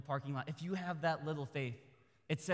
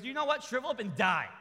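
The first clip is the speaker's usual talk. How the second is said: mock angry curse; 7 semitones higher, 6 dB louder than usual. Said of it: "He goes".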